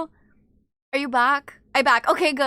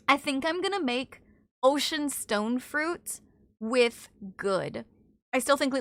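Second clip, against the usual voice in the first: normal talking voice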